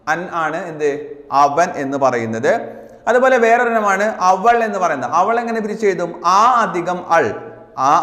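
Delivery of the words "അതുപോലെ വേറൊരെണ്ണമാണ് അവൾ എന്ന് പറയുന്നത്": usual speaking voice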